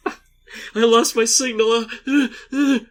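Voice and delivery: slow, high, loud mock swoon; fainting victim voice. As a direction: wheeze it out like you're blacking out, laughing.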